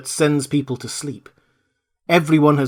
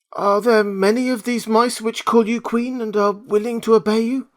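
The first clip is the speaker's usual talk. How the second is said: higher Scarecrow voice; 9 semitones higher than usual. Acted. Scarecrow asks the Queen about her mice, higher voice. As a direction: ask it as the straw man.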